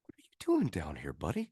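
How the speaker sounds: whispered, coaxing the creeper like a dog; hushed sweet-talk as if to a stray pup; tour guide coaxing a pet. Retold or imitated. Tour guide kneels and coaxes the creeper, whispered. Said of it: "he just goes".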